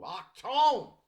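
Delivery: hammed-up drill sergeant shout; mock German command. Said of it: "Attention!" in German".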